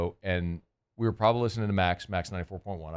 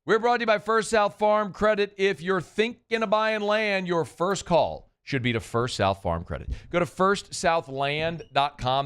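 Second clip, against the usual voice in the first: radio ad read; 4 semitones higher than usual. Acted. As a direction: sell it like a local radio spot. Live read of a sponsor ad.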